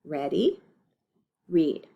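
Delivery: usual speaking voice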